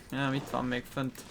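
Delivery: ordinary delivery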